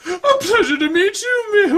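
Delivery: a bad Julia Child, cracking up; high Julia Child voice collapsing into laughter; Julia Child impression. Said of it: "a Julia Child impression".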